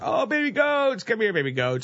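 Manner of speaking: put-on high cooing baby talk to goats. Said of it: "you're like walking in".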